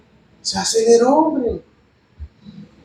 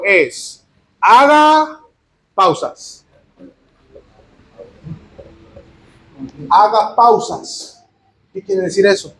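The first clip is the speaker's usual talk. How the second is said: "haga" drawn out, then long silences around "pausas"; deliberate pauses; slower than usual. Shown as a demonstration. Preacher practicing his own point about pausing, deliberate pauses. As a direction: leave big gaps between "haga" and "pausas". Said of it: "estoy haciendo una pausa".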